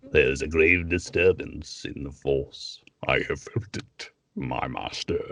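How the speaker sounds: slow villain voice sinking very low; Darth Vader. Acted. Voice actor doing Vader reporting to his master, deep and slow. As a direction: intone it deep and drawn out, breath in the voice. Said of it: "I know you do Vader very well".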